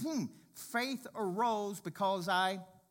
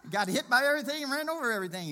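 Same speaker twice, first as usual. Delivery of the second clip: louder, higher, fast punchline; loud, fast emphasis; 8 semitones higher, 10 dB louder, faster than usual. Real, not acted. Driver joking about FedEx wrecks, loud and fast. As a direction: hammer it home laughing.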